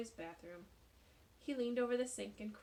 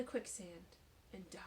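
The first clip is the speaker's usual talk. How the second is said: soft whispered narration; whispered ending; 2 semitones lower, 6 dB quieter than usual. A narrator's reading. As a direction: let the ending fade to a whisper. Story's grim ending, whispered.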